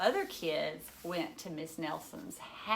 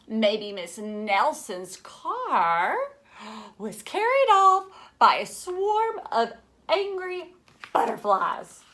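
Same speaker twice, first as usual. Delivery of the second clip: loud, slow, wide-eyed exclaiming; 3 semitones higher, 10 dB louder, slower than usual. Acted. Know-it-all kid exclaims a wild theory, loud. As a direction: make it a dramatic wild guess. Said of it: "exclaimed one know-it-all".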